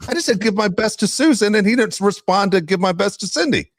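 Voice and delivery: high-pitched, incredulous retold complaint; mock indignation. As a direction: play the offended caller. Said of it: "I always wonder on the other end".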